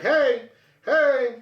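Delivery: high, mock calling for attention. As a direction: call to someone who is not responding. Calling to unresponsive deaf coworker.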